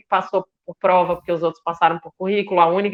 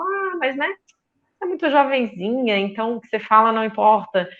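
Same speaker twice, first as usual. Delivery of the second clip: condescending pat-on-the-back; 3 semitones higher than usual. Retold or imitated. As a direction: imitate someone sweetly dismissing a young woman. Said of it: "batiam nas minhas costas e falavam".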